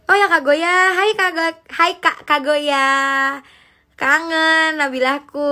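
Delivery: light up at a familiar name; excited greeting. Real, not acted. (sapaan girang, nada tinggi dan keras)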